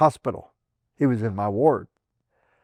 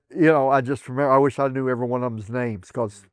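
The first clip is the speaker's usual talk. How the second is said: usual speaking voice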